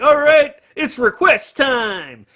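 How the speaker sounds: high, loud mock stage shout, stretched at the end; stage announcer. Frontman announcing to the crowd at the encore, imitated stage shout. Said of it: "and he's like".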